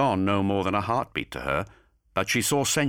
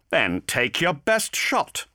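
slow, higher taunt; 10 semitones higher, 4 dB louder, slower than usual. A narrator's reading. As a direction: dare him, slowly. Garadon taunting.

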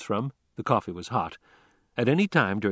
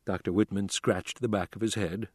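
plain reading voice

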